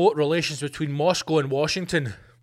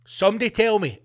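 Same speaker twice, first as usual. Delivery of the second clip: ordinary talk, nothing marked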